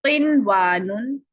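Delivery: slow किनवानुन with the नून sounded out clearly, not merged into the वाव; clear noon. Shown as a demonstration. Teacher showing students the noon kept clear. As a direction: stretch किनवानुन and let the नून ring distinct. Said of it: "नून को जाहिर करना है".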